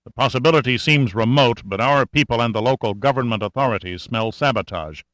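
ordinary delivery

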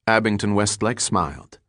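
ordinary delivery, nothing marked

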